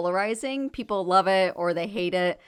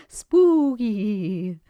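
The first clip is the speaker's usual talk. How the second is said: spooky voice; 2 semitones higher, 6 dB louder than usual. Acted. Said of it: "your spooky voice".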